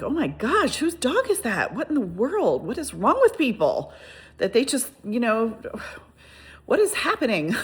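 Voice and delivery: high-pitched, incredulous questions; exasperated disbelief. Retold or imitated. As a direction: wonder aloud in dismay at careless owners. Reacting to stray dog in danger.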